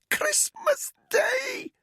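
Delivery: high, slow, crying word; strangled cry. Acted. Choking captive revealing the date, crying high and slow. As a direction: sob it out through the rope.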